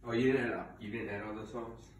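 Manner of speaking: ordinary talk, nothing marked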